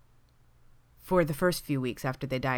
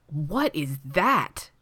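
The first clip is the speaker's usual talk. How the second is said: very slow, swooping astonished question; wide-eyed amazement; 3 dB louder, much slower than usual. A narrator's reading. Sidney sees the decadent pie, amazed. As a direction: draw it out in awe. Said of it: "eyes going wide".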